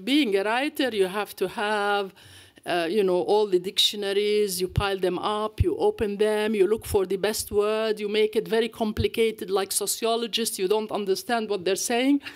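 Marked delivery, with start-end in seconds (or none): none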